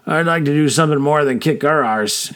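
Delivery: ordinary delivery, nothing marked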